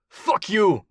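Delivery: high, defiant snarl; snarled, thick accent. A narrator's reading. Captive warlord to his interrogator, snarling in thick accent. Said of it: "snarled in his thick accent".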